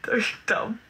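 whispered, voiceless remark; whispered aside. Real, not acted. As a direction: whisper it under your breath. Aside about commenters.

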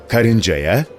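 usual speaking voice

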